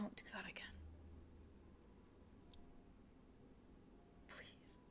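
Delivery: barely audible whispered plea; hushed plea. Acted. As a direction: beg under your breath, very softly. Tearful reunion after a resurrection.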